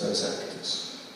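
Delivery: normal talking voice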